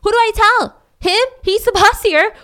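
high, loud panicked inner voice; frantic, asking herself who could help; desperate rising panic in her head; panicked thought. Retold or imitated. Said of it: "she's thinking".

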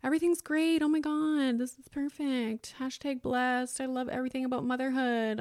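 mock gushing social-media mom; putting on a show. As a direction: fake everything-is-perfect cheer, starting high and easing down. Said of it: "put on a show".

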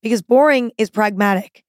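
punchy advert opening; ad read. Acted. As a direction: sell it with a bright hook. Second sponsor read.

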